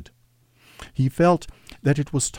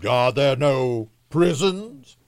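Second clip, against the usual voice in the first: high, drawn-out rhetorical question; accusing spirit; 7 semitones higher, slower than usual. A narrator's reading. The spirit turns Scrooge's own words on him, accusing. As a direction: throw it back slowly, rising at the end. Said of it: "turning on him for the last time with his own words".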